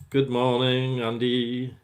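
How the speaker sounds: bright, loud hello across the room; loud greeting. Real, not acted. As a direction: call out a hearty good morning.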